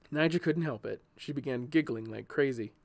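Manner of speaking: ordinary delivery, nothing marked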